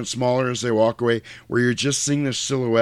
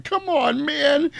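mock bawling plea; slower than usual. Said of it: "he's bawling".

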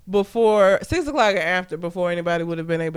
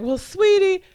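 sugary nurse; 11 semitones higher, 5 dB louder, slower than usual. Retold or imitated. Nurse voiced calling patient sweetie.

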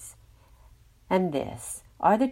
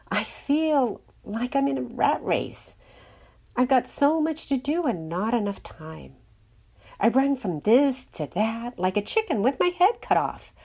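Clacy, exhausted sigh; 5 semitones higher than usual. A narrator's reading. Character moaning about the rat race, exhausted and sighing. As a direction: play up being worn out, pitched higher. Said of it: "sighed Clacy".

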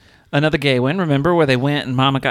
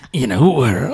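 trailer voice; 5 semitones lower than usual. Acted. Parody of trailer voice.

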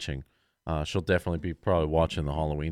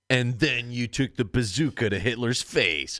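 mock game narrator; 4 semitones higher, slower than usual. Mocking cheesy history-game narration.

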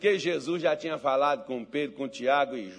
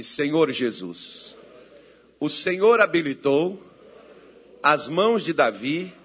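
loud, slow phrases with pauses between; fervent preacher's prayer, building to a shout; full-force prayer; 14 dB louder, slower than usual. Real, not acted. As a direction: lead the congregation line by line, forcefully. Leads congregation in prayer.

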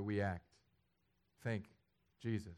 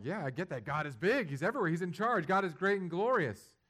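breezy churchgoer; 7 semitones higher, 7 dB louder than usual. Retold or imitated. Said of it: "you're like".

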